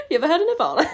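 high, loud pretentious question; mock music snob. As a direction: quiz them loudly as a parody poser.

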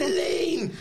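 high, sing-song gushing greeting; Graham Norton impression. Imitating Graham Norton greeting Elaine at a party. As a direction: gush her name way up high like a delighted chat show host. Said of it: "that was a good Graham Norton impression".

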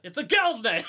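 high, loud yell with laughter; hammed-up yell; mock outraged shout. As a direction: holler it, breaking into a laugh. Repeats the yell after peaking mic.

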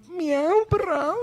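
high, loud comic meow; mock cat yowl. Shown as a demonstration. Showing friends her bedroom noises, high mock meowing. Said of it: "Hazle prau".

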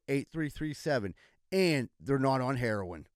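upbeat local radio ad, listing the services; sponsor ad read. Acted. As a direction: sell it like a hometown commercial spot. Reads a radio-style sponsor ad.

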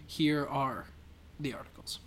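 usual speaking voice

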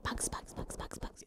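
very soft whispered repetition, all breath; imitated ASMR whisper. Imitating an ASMR girl at the mic, whispering. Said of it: "it was a girl doing, like, bugs".

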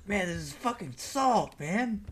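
slow mock movie delivery; bad acting. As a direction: deliver it like a cheesy hero. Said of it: "delivered the line like that".

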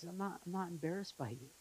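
a softly voiced loving Father; tender God. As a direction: gently tell a child they're not an embarrassment. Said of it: "he says".

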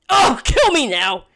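mock despair.

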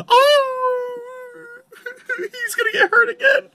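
high wailing cry; mock cry. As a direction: wail like a hurt puppy. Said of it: "ended that with a cry".

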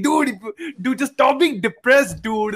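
high-pitched mock-exasperated bro; imitated preachy poster. Friend mocking advice about depression to his co-host, exaggerated glib bro. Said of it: "a post of someone who's like".

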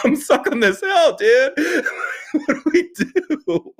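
very high, laughing plea; laughing helplessly. Retold or imitated. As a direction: laugh through it, begging for help. Stuck car, talking to vice principal.

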